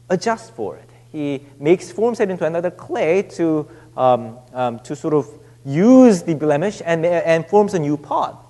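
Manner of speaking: ordinary talk, nothing marked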